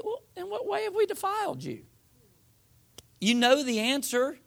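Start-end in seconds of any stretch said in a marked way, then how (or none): 0.0-1.8 very high, quick put-on voice; mock innocence. People answer God's question about defiling him, mock innocence. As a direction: play innocent in a high, imitating voice.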